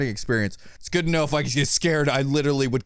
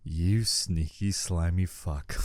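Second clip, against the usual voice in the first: grudging insult; 8 semitones lower, 4 dB quieter, slower than usual. Real, not acted. Got pranked by knock.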